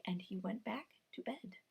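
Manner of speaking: whisper. A narrator's reading. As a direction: hush the line. Narrator whispers.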